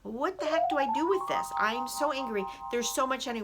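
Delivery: high, loud exasperated self-talk; acted anger. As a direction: play frustrated, pitch pushed up. Acts out angry self-talk.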